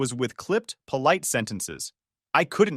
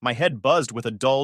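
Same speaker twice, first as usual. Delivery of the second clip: plain reading voice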